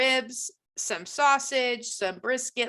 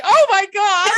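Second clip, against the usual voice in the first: high, loud cry of disgust; horrified outcry; 10 semitones higher, 10 dB louder than usual. Retold or imitated. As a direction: cry out in mock horror. Acting out horrified co-worker opening door.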